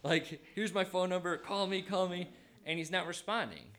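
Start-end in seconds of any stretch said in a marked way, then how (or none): 0.0-2.3 higher, urgent frantic message; acted-out frantic plea to call back. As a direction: blurt it out urgently, pitched up. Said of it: "like, frantically".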